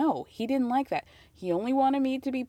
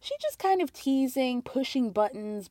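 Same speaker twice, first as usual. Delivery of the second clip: ordinary delivery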